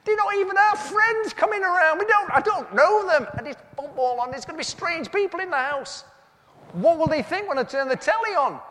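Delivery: husband panicking to wife about strangers and the telly; panicked high protest. Retold or imitated. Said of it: "I went".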